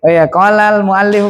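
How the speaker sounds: usual speaking voice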